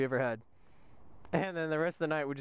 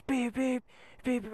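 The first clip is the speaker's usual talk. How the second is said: higher-pitched mock alarm beeps; imitated smoke alarm; 7 semitones higher than usual. Smoke detector suddenly goes off in the story, imitated alarm. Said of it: "smoke detector alarm goes off".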